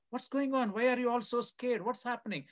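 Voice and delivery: lightly voiced woman asking what's wrong; a touch of the alarmed woman. The wise woman asking the scared townspeople, lightly alarmed. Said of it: "She says".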